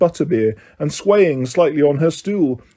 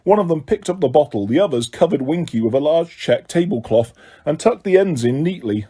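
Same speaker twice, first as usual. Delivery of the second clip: ordinary delivery, nothing marked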